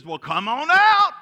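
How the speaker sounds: loud, high shout rising at the end; hammed-up beckoning yell; calling out. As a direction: holler an invitation across the water. Jesus acted, calling Peter out.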